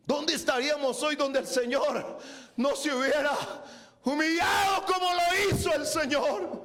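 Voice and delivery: sermon building to shouted exclamation; fervent preaching. Real, not acted. Preacher marvelling at the King's humiliation, fervent preaching.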